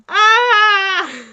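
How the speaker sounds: high loud scream melting into laughter; shrieking laughter. Real, not acted. Player thrilled by the kiss, screaming laughter. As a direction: scream then laugh with glee.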